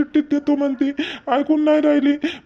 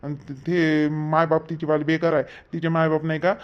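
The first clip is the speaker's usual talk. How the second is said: much lower, much softer wheedling; whispered bribe offer; 9 semitones lower, 7 dB quieter than usual. Acted. Husband quietly offers the cop money to settle, hushed. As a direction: murmur the bribe under your breath.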